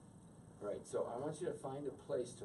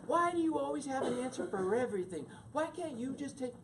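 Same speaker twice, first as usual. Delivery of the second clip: much higher, louder frustrated whine; exasperated pleading; 12 semitones higher, 3 dB louder than usual. Retold or imitated. Arguing with his wife, high exasperated plea. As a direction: beg her to just accept it.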